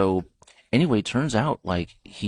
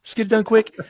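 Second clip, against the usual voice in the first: Duck Dynasty guy; 8 dB louder than usual. Retold or imitated. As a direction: ham up a careless good ol' boy. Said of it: "the guy on Duck Dynasty said".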